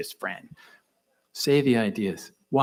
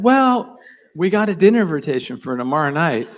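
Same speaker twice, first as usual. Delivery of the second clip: much higher, louder, fast with laughter; laughing announcement; 5 semitones higher, 10 dB louder than usual. Real, not acted. Speaker telling his wife about the dinner, laughing. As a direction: blurt it out laughing.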